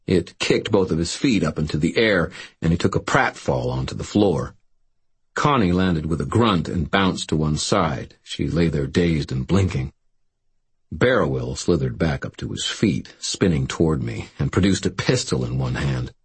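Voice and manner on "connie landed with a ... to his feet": ordinary delivery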